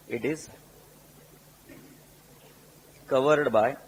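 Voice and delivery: word by word with long pauses; slow dictation. Real, not acted. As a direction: dictate slowly so students can write. Dictating after "So write down. (रुक-रुक कर लिखवाते हुए)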